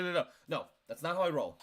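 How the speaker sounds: ordinary delivery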